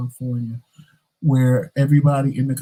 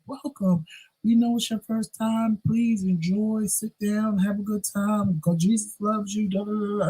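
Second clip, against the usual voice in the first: higher, softer sweet greeting; welcoming greeters; 7 semitones higher, 4 dB quieter than usual. Retold or imitated. Church greeters welcoming a first-timer, higher and softer. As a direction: gently welcome the newcomer. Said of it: "people are welcoming you".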